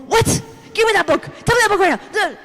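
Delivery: high, loud panicked demands; freaking-out father. Retold or imitated. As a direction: imitate a dad suddenly losing his cool. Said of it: "And he freaks out".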